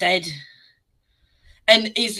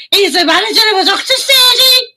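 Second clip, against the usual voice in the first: high, loud, giggly hammed-up Scouse; over-the-top Scouse impression; Scouse accent; 12 semitones higher, 8 dB louder than usual. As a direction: shout it in silly high Scouse, on the edge of laughing. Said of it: "I was talking like that".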